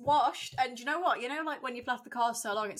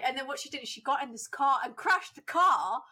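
ordinary talk

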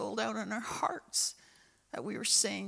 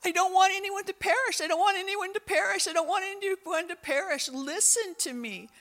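higher and louder, pleading, fading at the end; God crying out; 8 semitones higher, 4 dB louder than usual. Acted. God crying out to people not to perish, pleading. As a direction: plead as if no one may be lost. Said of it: "God is crying out for people".

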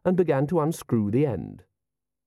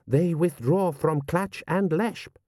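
slow, raised insistence; insistent; 6 semitones higher, slower than usual. A narrator's reading. General urging the prince to accept, insistent. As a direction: press the point deliberately. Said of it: "General Ashal insisted".

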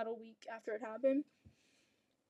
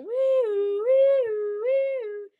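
high louder wee-oo siren noise; 10 semitones higher, 8 dB louder, much slower than usual. Retold or imitated. Describing her delirious spinning, high wee-oo noise. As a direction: sing out a spinning wee-oo, much higher. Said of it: "I'm spinning in circles".

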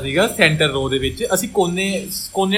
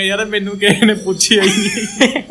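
laughing mock complaint; 3 semitones higher than usual. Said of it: "ਬਾਅਦ ਵਿੱਚ ਕਹੇਗਾ".

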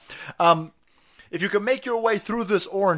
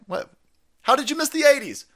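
ordinary talk

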